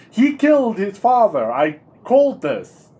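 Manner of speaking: triumphant gotcha; excited realization. Real, not acted. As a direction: crow that you called it. Realizes the murderer.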